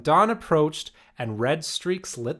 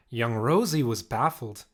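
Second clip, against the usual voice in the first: plain reading voice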